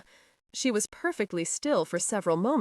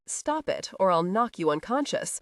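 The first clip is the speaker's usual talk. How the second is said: ordinary delivery, nothing marked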